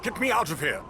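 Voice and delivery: high, frantic cry to be let out; a shout for rescue from inside a prison; desperate shout for help. Acted. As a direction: yell it like you're trapped and panicking. Trapped, begging to be freed.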